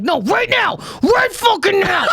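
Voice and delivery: high, slowed, through-the-teeth demand; gritted-teeth demand. Retold or imitated. Demanding her money, a high gritted-teeth mock shout. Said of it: "like the gritted teeth thing".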